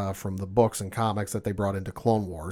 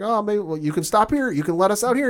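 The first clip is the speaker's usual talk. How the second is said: nervous request; 9 semitones higher, 3 dB louder than usual. Retold or imitated. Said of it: "They're like".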